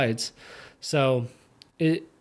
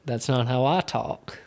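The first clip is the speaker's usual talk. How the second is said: ordinary delivery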